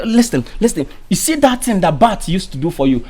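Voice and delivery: ordinary talk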